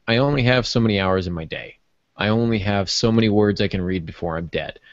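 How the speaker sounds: ordinary talk, nothing marked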